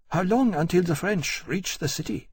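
a hint of a frightened queen keeping composure; composed queen. A narrator's reading. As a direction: ask with held-in worry. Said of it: "very pale, but composed".

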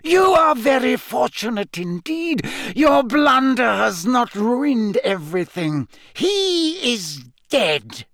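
villain voice pitched very high; cold, high-pitched voice. Acted. Voldemort telling Wormtail of his luck, cold high-pitched voice. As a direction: pronounce the verdict in a high, icy tone. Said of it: "high-pitched voice from the depths of the chair".

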